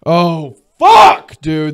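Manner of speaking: loud, high exasperated outburst; pissed off. Real, not acted. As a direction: burst out in sudden irritation. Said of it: "This one pisses me off".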